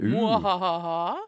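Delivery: slow evil cackle; mad scientist laugh. Pretend. Reads menu, evil laugh.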